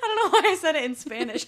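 fast, high, breaking into laughter; laughing. Real, not acted. Laughing at her own Spanish slip to her cohost. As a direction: giggle out the words.

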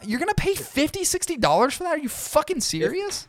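high-pitched mock outrage; incredulous. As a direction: squeal in disbelief at the price. Outraged at the price of Cohibas.

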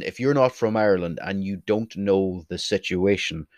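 ordinary talk, nothing marked

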